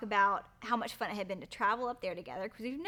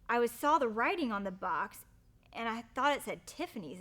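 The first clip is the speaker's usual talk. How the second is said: ordinary delivery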